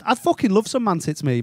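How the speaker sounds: usual speaking voice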